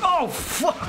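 sudden high, loud shocked yell; startled outcry. Real, not acted. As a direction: blurt it out as the horse goes down. Horse mishap, startled outcry.

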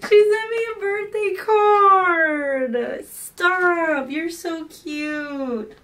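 high, slow, near-tearful delight; real happy near-sob; touched squeal. Real, not acted. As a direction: gush as if about to cry happy tears. Touched by birthday card.

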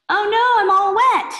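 high, loud cartoon kid voice; a clumsy kid yelping in dismay; squeaky Klutzy Boy.

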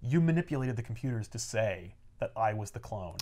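low, soft, acted reveal; quiet accusation. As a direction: lay out the charge low and quiet. Peter quietly lays out accusation.

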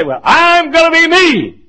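high, loud, slow stubborn boast; mocking the stubborn churchgoer, high and loud; mock defiance. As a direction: puff up and insist on yourself. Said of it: "sorry, we say".

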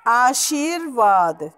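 आशीर्वाद slowly, in stretched syllables; careful model pronunciation. Shown as a demonstration. Teacher shows where r falls, slow आशीर्वाद. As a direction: draw out aa-sheer-vaad in आशीर्वाद. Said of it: "श के बाद र".

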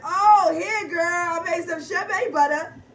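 high, loud mocking voice of a seller. Vlogger mocks a friend offering homemade shea butter, high and loud. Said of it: "And then they said".

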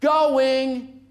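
slow, drawn-out final calls, rising; auctioneer calling. Real, not acted. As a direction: stretch out going, going. Final calls before the hammer.

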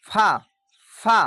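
teacher models the fa sound; lip and teeth fa. Shown as a demonstration. শিক্ষক ছাত্রদের জন্য দাঁত ঠোঁটে লাগিয়ে ফা উচ্চারণ করেন. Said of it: "নিচে দাঁতের সঙ্গে লেগে হচ্ছে দেখেন".

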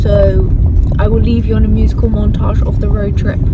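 loud outdoors over the wind; shouting over wind. Real, not acted. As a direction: speak up against the wind. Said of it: "You might not have heard us".

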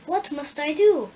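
put-on young Dorothy asking eagerly; girl's question. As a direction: ask it like a worried child. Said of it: "asked the girl".

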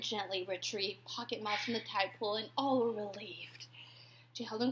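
soft tender whisper. A narrator's reading. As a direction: hush it like a secret. Tender rescue moment.

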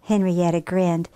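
ordinary delivery

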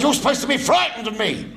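high, loud drill sergeant bark; barking chief petty officer. Retold or imitated. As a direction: bellow it like a feared naval chief. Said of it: "He said".

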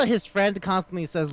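usual speaking voice

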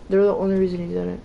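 usual speaking voice

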